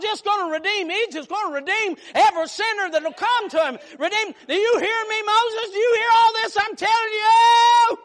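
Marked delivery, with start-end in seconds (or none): 4.0-8.0 high, loud, crying preacher shout; shouted sermon climax with a sob in it; preaching cry. Real, not acted. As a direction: wail the question out.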